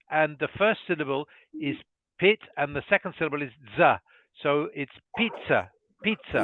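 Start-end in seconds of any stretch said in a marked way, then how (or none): 2.2-6.4 pizza in two syllables, PEET-sa. Shown as a demonstration. Teacher breaks pizza into pit and za for the student. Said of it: "two syllables".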